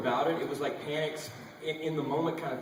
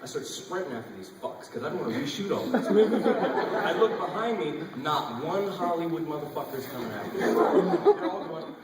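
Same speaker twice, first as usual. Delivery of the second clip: ordinary talk, nothing marked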